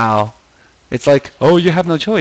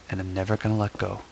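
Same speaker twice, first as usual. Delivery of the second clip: mock-devoted murmur; 4 semitones lower, 8 dB quieter, faster than usual. Clutching the can.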